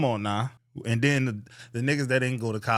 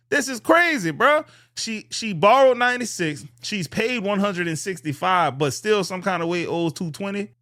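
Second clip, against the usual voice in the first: much higher and louder, indignant; outraged exclamation; 7 semitones higher, 4 dB louder than usual. Real, not acted. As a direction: let the outrage burst out over her numbers. Outraged reaction to her loans.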